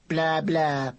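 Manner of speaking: mocking sing-song blah. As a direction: tease with a nonsense reply. George mocks Laura.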